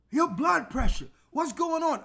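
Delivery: mock-panicked nurse, voice jumping up; lightly voiced nurse gasping at a reading; alarmed nurse. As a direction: play the startled nurse, a bit hammed-up.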